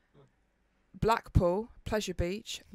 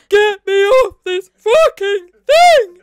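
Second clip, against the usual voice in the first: much louder, higher, slow sobbing yell; panicked shout; 11 semitones higher, 20 dB louder, slower than usual. Retold or imitated. At the bottom of the ride, reenacting a slow, sobbing yell. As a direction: wail it as if trapped and terrified. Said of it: "I'm uncontrollable at this point".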